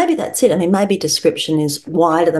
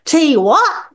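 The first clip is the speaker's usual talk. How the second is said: a mock whisper that comes out as a high, louder bellow; tongue-tied bellow; 10 semitones higher, 4 dB louder than usual. Tipsy dad blurts at the table, a mock tongue-tied bellow. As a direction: blurt it, confused and too loud. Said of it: "his whisper emerged as a tongue-tied bellow".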